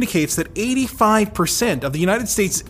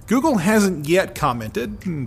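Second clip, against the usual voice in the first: ordinary talk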